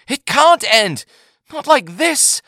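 high, loud desperate pleading; desperate protest. A narrator's reading. Yagar protests to the Advocate General, high and desperate. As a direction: refuse to accept it.

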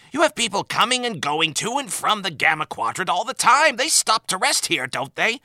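fed up with nobody believing him; exasperated outburst. Acted. Admiral complaining to Sisko about his vacation, exasperated outburst. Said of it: "The Admiral heaved".

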